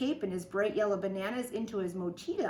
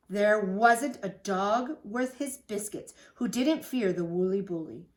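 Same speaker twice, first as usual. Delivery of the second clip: ordinary delivery, nothing marked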